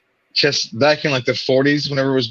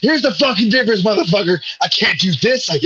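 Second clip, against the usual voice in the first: much higher, hammed-up furious rant at the doctor; mock enraged outburst; 13 semitones higher than usual. Friend mimicking him exploding at his doctor, high mock fury. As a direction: squeal it out like a cornered man losing it.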